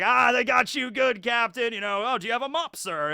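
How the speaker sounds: loud, high teasing shout; mock jeering. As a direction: heckle the captain like a rowdy crew. Crew teasing their captain.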